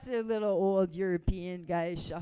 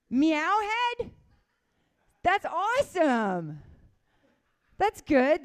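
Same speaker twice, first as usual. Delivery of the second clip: delighted surprise; 8 semitones higher, 7 dB louder, slower than usual. Real, not acted. Reading audience stripper name.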